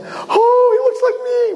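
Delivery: very high, hammed-up cooing squeal; gushing mother. Mother seeing her newborn, high gushing voice. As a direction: squeal it like a delighted new mother. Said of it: "he hears his mother saying".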